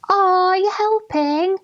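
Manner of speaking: patronising passers-by. Retold or imitated. Passers-by patronising the speaker on farm.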